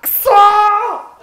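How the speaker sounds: long drawn-out frustrated yell; 悔しい叫び. Real, not acted. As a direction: stretch out a loud groan of defeat. ゲームで失敗して叫ぶ. (長く伸ばした悔しい叫び)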